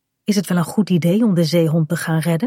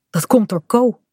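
plain reading voice

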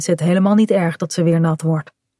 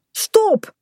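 very high, alarmed call; urgent cry. A narrator's reading. Kaat orders Bink to stop for the fallen dog, urgent cry. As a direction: cry out to halt them at once.

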